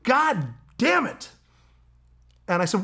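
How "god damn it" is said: high, slow mock yell of frustration; retold yell. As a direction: drag out a high frustrated curse. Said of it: "puts the gun down and yells".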